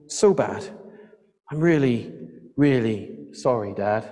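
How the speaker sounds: halting, sinking apology; remorseful. A narrator's reading. Boy's remorseful confession to father.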